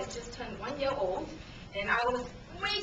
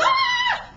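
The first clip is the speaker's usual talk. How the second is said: high, loud shriek; acted terrified scream; 9 dB louder than usual. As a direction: scream as if falling from a bridge. Screaming during bungee fall.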